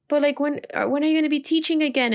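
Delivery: quick curious student; kid asking. Retold or imitated. Student asking teacher when she'll teach again, high and quick. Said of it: "I had some questions right after".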